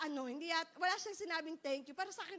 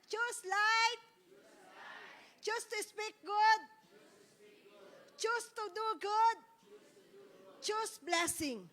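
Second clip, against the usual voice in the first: higher, louder, slow shouted mottos; loud slow proclamation; 4 semitones higher, 4 dB louder, much slower than usual. Shown as a demonstration. Preacher leading class in repeating mottos, slow and shouted. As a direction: shout each motto slowly for them to repeat. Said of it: "Ulitin po natin".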